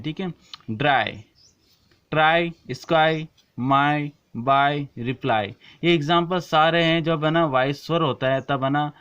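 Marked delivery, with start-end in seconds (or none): 0.7-5.5 slow list, each word ending in a long eye; model words. Shown as a demonstration. Teacher lists example words for students, word by word. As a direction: say each word alone, stressing the eye sound. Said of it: "ई है जो इसके साथ में है".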